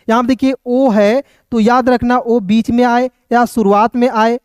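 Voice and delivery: normal talking voice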